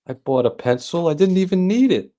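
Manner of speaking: Fred Stoller quote. Retold or imitated. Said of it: "he says, like".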